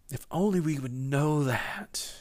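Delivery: hushed, slow longing aside; whisper. Real, not acted. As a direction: breathe it like a private wish. Wistful aside during sermon.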